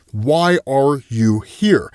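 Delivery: very slow, evenly weighted question; solemn questioning. Acted. Existence questions Marshall on his purpose, solemn questioning. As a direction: draw out each word like an all-knowing interrogator.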